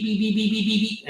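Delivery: high, quick electronic beeps; imitated warning beeps. Pilot imitates the cockpit warning tone with high beeps. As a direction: beep them out like a cockpit alarm. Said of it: "you hear the beep".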